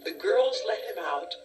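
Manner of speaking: usual speaking voice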